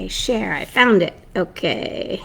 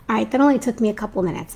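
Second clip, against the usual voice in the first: ordinary talk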